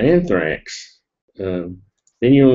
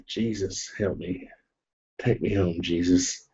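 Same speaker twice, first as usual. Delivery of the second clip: soft, hammed-up plea to heaven; softer, a touch of mock despair; mock quiet plea; 3 semitones lower, 9 dB quieter than usual. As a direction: murmur it like a weary half-joking prayer. Mock plea after pepper spray.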